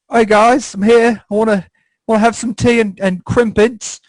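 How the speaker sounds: high, hammed-up British accent; badly faked British greeting, pitched well up; put-on British accent. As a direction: do a cheesy fake British accent. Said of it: "I had a little bit of an accent".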